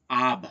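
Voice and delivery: আব isolated, loud, with open "aa" closing on "b"; aab sound demonstrated. Shown as a demonstration. Teacher answering how the word is pronounced, আব sounded out loudly. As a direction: sound out আব firmly as one syllable. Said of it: "পাবক উচ্চারণে কি হবে".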